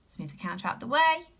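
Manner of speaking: ordinary talk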